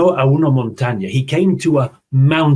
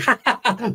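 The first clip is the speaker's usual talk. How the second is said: high rabbit giggle; 9 semitones higher than usual. Acted. Rabbit laughing.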